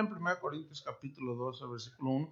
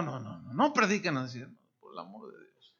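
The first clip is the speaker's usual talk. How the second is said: usual speaking voice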